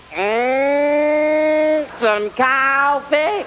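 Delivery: slow, stretched Southern drawl; syrupy slow Southern "some coffee". Retold or imitated. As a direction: drag the words out lazily. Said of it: "And it becomes".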